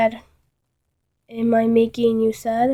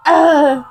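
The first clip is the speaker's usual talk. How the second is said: strangled grunt, louder, pitched up; choked grunt; 4 semitones higher, 7 dB louder than usual. Acted. Narrator grunting as arms squeeze his neck. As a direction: gasp as the arms squeeze the throat.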